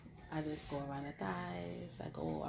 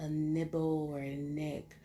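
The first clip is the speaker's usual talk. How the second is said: ordinary talk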